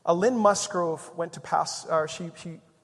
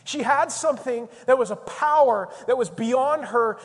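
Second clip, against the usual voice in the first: loud, raised preaching voice; impassioned preaching; 5 semitones higher, 7 dB louder than usual. Real, not acted. Preacher honouring a woman who suffered, impassioned preaching. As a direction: drive each phrase home with conviction.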